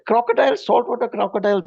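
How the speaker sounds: mildly acted amazement; retold disbelief. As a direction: echo it back, astonished. Retells his disbelief at the locals' answer.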